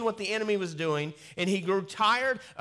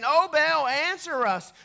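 crying out to Baal; 11 semitones higher, 6 dB louder, slower than usual. Acted.

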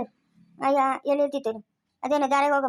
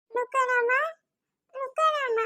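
high-pitched tearful wail; keening lament in a small high voice; crying; 9 semitones higher, 3 dB quieter, much slower than usual. Acted. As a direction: sob softly between drawn-out words. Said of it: "ಅಳ್ಬಾರ್ದು, ಸುಮ್ನೆ ಇರು".

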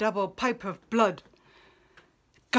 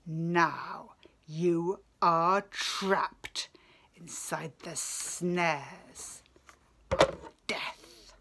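slow, whispered threat of doom; menacing whisper; 4 semitones lower, 5 dB quieter, much slower than usual. Acted. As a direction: hiss the trap closing, slowly. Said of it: "and sneered".

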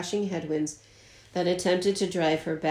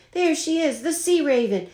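high pitched triumphant call that settles at the end; captain calls out to the crew; roaring excitement; 6 semitones higher than usual. Acted. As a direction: shout the news across the deck. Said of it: "he roared".

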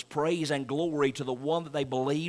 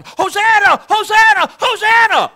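much higher and louder Hosanna cries; crowd shouting; 16 dB louder than usual. Retold or imitated. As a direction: cry out like the crowd. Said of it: "The shouting is still going on".

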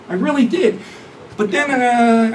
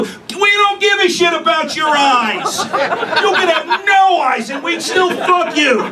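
laughing shout; 7 semitones higher, slower than usual. Acted. Comic yelling at online daters.

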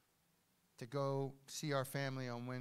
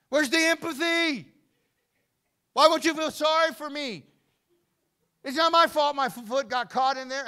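put-on high, shouted protest of a sulking child; loud, high-pitched mock outrage; hammed-up indignant whining; 12 semitones higher, 16 dB louder than usual. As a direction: yell it like a wounded, self-pitying victim. Acting his hurt, complaining reply to wife.

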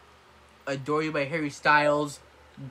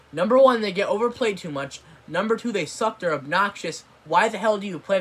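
angry rant; 5 semitones higher, 5 dB louder than usual. Real, not acted. Ranting about overplayed radio songs.

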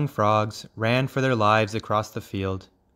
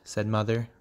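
plain reading voice

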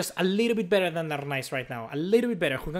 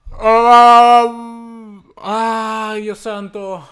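loud anguished cry fading; high anguished cry fading; anguished groan; 5 semitones higher, 12 dB louder, much slower than usual. Real, not acted. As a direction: despair at the goal. Despair at conceding.

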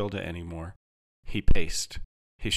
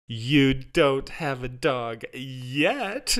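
very slow, higher, drawn-out coo; syrupy slow reassurance; 5 semitones higher, much slower than usual. A narrator's reading. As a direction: coo it sweetly, stretching every word. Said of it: "Jarvis cooed".

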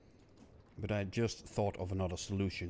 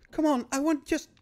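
strained frustrated cry; 10 dB louder than usual. Real, not acted. Stuck on a frustrating game puzzle.

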